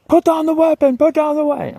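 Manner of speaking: high, loud police command; cops shouting. Retold or imitated. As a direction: bark the order like a cop at the door. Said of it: "'Cause they're like".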